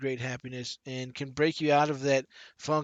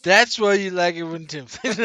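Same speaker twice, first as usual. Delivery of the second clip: laughing tease; 4 semitones higher, 10 dB louder than usual. Real, not acted. Teasing co-host after a joke.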